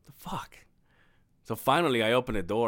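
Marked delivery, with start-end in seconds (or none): 0.0-0.6 hushed, baffled the fuck; a muttered, puzzled aside; whispered disbelief. Retold or imitated. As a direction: whisper it to yourself in confusion. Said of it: "And I'm like".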